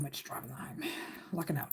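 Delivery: hushed side comment, then back to normal; whispered aside. Real, not acted. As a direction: whisper it under your breath. Aside under breath about long drive.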